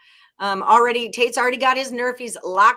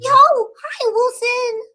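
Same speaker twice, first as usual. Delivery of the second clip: much higher, louder, cartoonish hi; squeaky put-on greeting; 8 dB louder, much slower than usual. Host greeting Wilson in a squeaky put-on voice. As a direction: squeal the name like a cartoon character.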